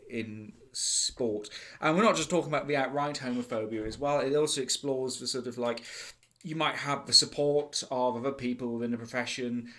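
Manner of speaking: usual speaking voice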